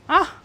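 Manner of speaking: sudden high loud yelp of surprise; startled yell. Real, not acted. Player startled by the game, loud surprised yelp. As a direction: caught off guard, cry out sharp and loud. Jump scare in platforming.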